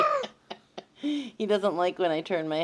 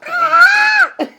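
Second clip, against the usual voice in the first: high squealing laugh; shrieking laugh; 13 dB louder than usual. Real, not acted. Tickled, shrieks.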